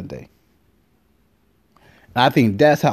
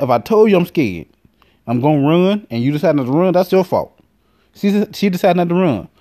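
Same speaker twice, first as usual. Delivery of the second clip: usual speaking voice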